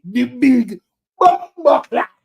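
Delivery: slow booming name call; ring announcer voice. Pretend. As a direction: announce yourself like a wrestler. Introduces himself ring style.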